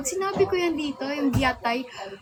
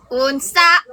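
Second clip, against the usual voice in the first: loud mock Bisaya accent, rising at the end; punchy attempt at a Bisaya accent; 11 dB louder than usual. Shown as a demonstration. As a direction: try on a heavy Bisaya accent. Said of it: "dapat may accent yung Bisaya".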